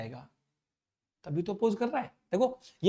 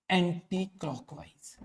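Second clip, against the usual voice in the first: Anti clockwise spaced out slowly and softer; slow repetition; 4 semitones lower, 6 dB quieter, slower than usual. Shown as a demonstration. Teacher repeating the current's direction for students, slow repetition.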